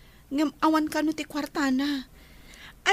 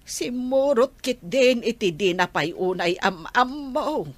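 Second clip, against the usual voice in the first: lamenting elder; 10 semitones lower than usual. Acted. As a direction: sink low and drag out the lament. Elder lamenting the grandchild's choice.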